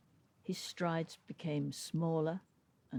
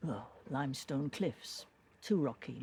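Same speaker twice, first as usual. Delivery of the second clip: ordinary delivery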